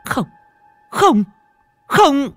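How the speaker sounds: high, loud cries of refusal; building to a high shout of horror; terrified scream. A narrator's reading. Hoa waking from a nightmare, high loud scream. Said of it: "Cô Hoa hét lên một tiếng".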